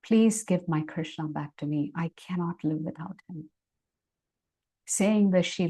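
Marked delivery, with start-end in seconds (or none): none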